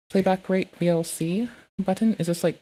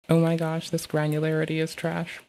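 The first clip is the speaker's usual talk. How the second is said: ordinary delivery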